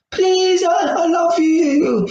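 hammed-up heartbroken plea; mock begging. Dumped boyfriend begging on the phone, mock pleading wail. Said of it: "beg the girl back".

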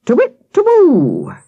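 high, loud, drawn-out owl call; defiant owl hoot. A narrator's reading. Akela carrying on despite interruptions, hooting defiantly. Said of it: "Akela continued defiantly".

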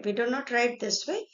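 ordinary talk

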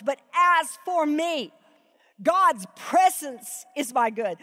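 very slow, each word weighted; reverent, deliberate verse reading; slow emphatic reading. Shown as a demonstration. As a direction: linger over God's presence. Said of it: "Do you hear what he's doing".

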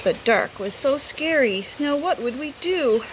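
ordinary delivery

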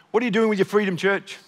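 ordinary talk

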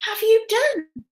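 high, loud, laughing welcome; happy children greeting. A narrator's reading. Class One welcoming the bear home, high and laughing. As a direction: greet the bear like a lost friend. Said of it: "carry him happily home".

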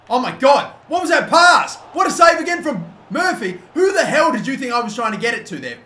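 high, loud cry of disbelief; exasperated shouting. Real, not acted. Gamer protesting a wasted pass and a save, shouting. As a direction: yell it in outraged disbelief.

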